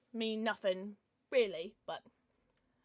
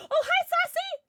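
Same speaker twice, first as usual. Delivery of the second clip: very high, loud laughing hello; delighted greeting; 9 dB louder than usual. Real, not acted. Owner greeting her cat Sassy, squealing.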